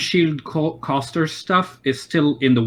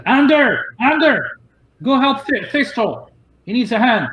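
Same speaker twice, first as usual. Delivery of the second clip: calling out; 10 semitones higher than usual. Acted. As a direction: call the kid over from a distance. Shopkeeper calls the boy Ander.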